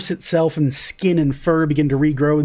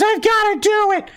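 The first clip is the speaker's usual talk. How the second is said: very high, squeaky protest; hammed-up tiny cookie desperation; desperate gingerbread boy. Gingerbread boy answering his worried buddy, desperate.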